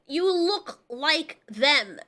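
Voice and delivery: very slow, each word spelled out; emphatic slow. Real, not acted. Reviewer lecturing the villain, very slow and emphatic. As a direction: lay it out slowly for someone dense. Ranting at Koopa's stupidity.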